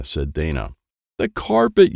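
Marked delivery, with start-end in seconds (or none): 1.2-1.9 high, loud alarmed yell; aunt yelling as mud nears the carpet. A narrator's reading. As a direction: shriek it to save the carpet. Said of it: "yelled Aunt Susie".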